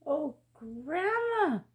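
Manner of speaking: slow, high, a touch of fright; mild gasp of fear at the teeth; girl to grandma, understated alarm. Acted. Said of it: "gasped the little girl".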